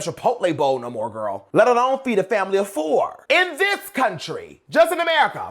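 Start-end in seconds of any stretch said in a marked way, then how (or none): 1.5-5.5 higher and louder, worked-up ranting; indignant, climbing and louder; heated rant. Real, not acted. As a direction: rant it, getting mad. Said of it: "that just gets me so mad".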